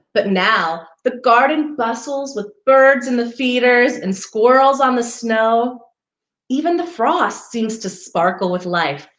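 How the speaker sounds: gentle storybook read-aloud; reading to children. Acted. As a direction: read it like a picture book for little ones. Reading picture book to children.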